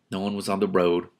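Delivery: ordinary delivery, nothing marked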